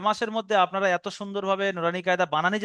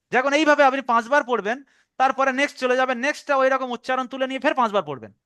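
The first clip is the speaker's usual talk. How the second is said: usual speaking voice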